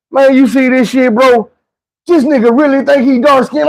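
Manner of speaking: high-pitched incredulous jeer; mocking disbelief. Onlookers laughing at the narrator's claim, mocking disbelief. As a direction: marvel mockingly to your buddies, pitched high. Acts out others mocking him.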